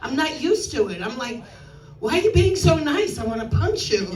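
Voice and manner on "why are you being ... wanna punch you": irritated outburst, higher and louder; mock confrontation. Newcomer baffled by nice Oregonians, comically aggressive. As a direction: snap it like an affronted New Yorker.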